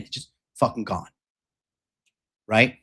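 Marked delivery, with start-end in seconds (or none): none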